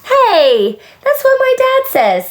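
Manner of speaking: put-on very high, delighted child's exclamation; bright, high burst through a smile; smiling. A narrator's reading. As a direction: pipe up loudly with happy recognition. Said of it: "Sam smiled".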